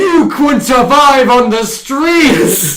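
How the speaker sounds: drawn-out, high shouted film quote; mock screaming. Quoting the trafficker to a kid and woman, mock screaming. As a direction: yell it slowly, every word stretched. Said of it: "starts screaming at them".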